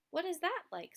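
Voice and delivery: a little seed curious about the sun. Acted. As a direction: voice the seed asking with wonder. Seed asks about sunrise.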